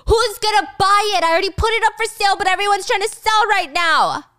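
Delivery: high, loud, fast exasperation; angry Michael. Retold or imitated. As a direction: snap back at her loudly. Michael snapping at Gia.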